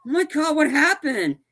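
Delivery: high-pitched pretend panic; mock distressed niece. Niece questions and old man blames the worker, high mock alarm. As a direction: imitate her alarm, voice way up. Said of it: "his niece".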